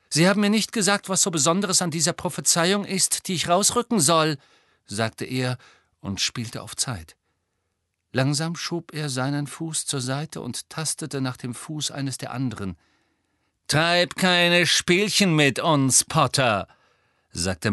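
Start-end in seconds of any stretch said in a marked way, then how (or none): 0.1-4.4 higher-pitched, pressing complaint; challenging young hero. A narrator's reading. Harry playing for time, challenging. 13.7-16.7 slow, louder, deliberate warning; menacing villain. A narrator's reading. Malfoy warning Harry to stop stalling, menacing.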